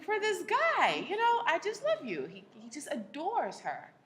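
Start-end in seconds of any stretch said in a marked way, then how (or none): 0.0-2.3 high, pleading husband imitation; hammed-up baffled husband; husband's plea. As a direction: whine it like a puzzled doting husband.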